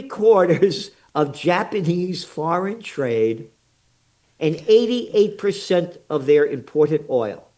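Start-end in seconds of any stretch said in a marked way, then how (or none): none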